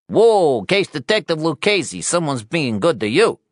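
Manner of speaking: high-pitched, loud, needling reporter. Acted. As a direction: needle him in a high, pushy voice.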